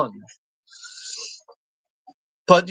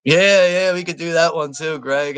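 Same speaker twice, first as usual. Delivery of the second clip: normal talking voice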